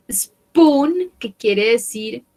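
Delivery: ordinary talk, nothing marked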